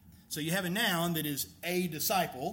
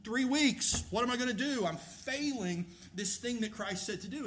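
worked-up worrier, pitched higher and rushed; mock panic; 4 semitones higher than usual. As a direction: act out someone fretting that they are failing. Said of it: "You can find yourself in a place where".

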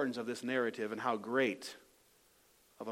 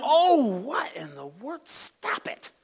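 high, drawn-out mock exasperation, ending in a hushed hiss; acted annoyed bystanders; 7 semitones higher, slower than usual. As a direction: play a bystander fed up with the din. Acting out annoyed bystanders hushing Bartimaeus.

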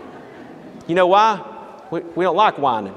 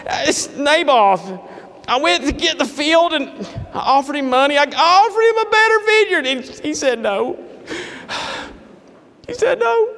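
whining Ahab; 8 semitones higher than usual. Acted. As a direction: whine like a toddler king. Ahab whines about Naboth.

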